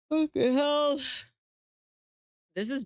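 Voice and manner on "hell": ordinary delivery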